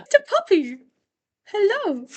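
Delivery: very high, cooing greeting. Real, not acted. Host cooing at her puppy.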